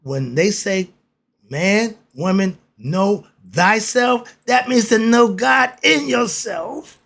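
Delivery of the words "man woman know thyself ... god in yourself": higher and louder, slowing to drive it home; carried away by the point, voice climbing; excited. Real, not acted. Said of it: "Sorry, I get excited".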